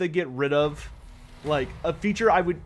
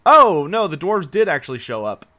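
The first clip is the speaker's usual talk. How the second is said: surprised; 10 dB louder than usual. Real, not acted. Enemy dwarves suddenly show up.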